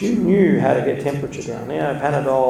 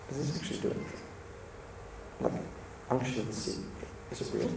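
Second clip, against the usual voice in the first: much softer, doubting; quiet inner thought; 14 dB quieter, faster than usual. Retold or imitated. Priest doubting the oil silently while anointing, soft. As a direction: murmur it to yourself. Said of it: "in his head, he's saying to himself".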